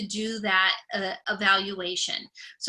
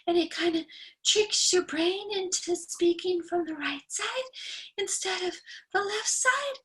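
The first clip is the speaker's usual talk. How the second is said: Marilyn Monroe; 7 semitones higher, 6 dB quieter than usual. Shown as a demonstration. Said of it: "talk like this".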